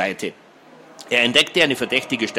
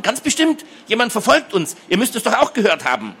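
high, insistent alarmed voice; shrieking; 10 semitones higher than usual. A narrator's reading. Girl insisting someone is following them, shrieking. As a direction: insist in a high-pitched panic. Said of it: "kreischte Hedi erneut".

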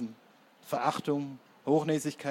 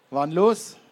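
loud, raised, rising query; loud casual question; 4 semitones higher, 10 dB louder than usual. A narrator's reading. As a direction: ask bluntly what happened. Blonder fragt locker und laut nach. (laute, saloppe Frage)

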